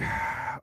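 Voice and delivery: long breathy sigh; exasperated sigh. Retold or imitated. Man giving up on finding the game, exasperated sigh. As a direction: let out a fed-up exhale. Fed up with the search.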